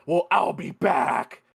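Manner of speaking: drawn-out, hammed-up villain threat; Gabriel. Gabriel warning Madison he will return, drawn-out mock menace. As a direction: stretch it into a mock villain's call. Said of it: "Gabriel's like".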